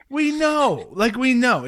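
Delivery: high, loud fed-up retort; exasperated. Acted. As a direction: push it out, exasperated. Exasperated answer to advice-givers.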